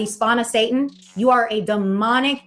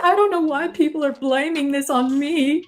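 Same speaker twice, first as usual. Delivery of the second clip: higher mock-whiny crying voice; hammed-up sob story voice; 4 semitones higher than usual. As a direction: imitate her fake tears, whining. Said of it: "she was crying".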